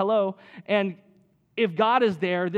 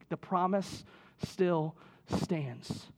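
softer and slow, every word weighed; slow soft emphasis; 2 semitones lower, 8 dB quieter, much slower than usual. Shown as a demonstration. Preacher softly tells the congregation the promise stands. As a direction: let it land gently and slowly. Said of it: "Maybe God is saying the same thing to you".

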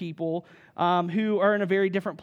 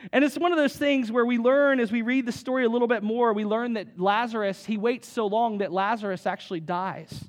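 usual speaking voice

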